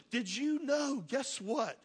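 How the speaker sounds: feigned wide-eyed surprise after a gasp; mock amazement. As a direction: play up astonishment at big news. Feigning amazed news about children's ministry.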